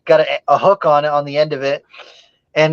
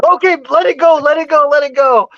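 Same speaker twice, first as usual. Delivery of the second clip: mock-panicked plea to drop the snake; frightened dad; 10 semitones higher than usual. The frightened dad after the camera flash, imitating anxious pleading.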